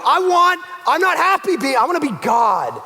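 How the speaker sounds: high, loud demanding outburst; a child stamping to be God; petulant rebel. Acted. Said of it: "We go".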